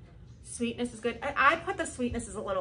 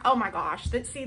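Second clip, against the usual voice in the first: normal talking voice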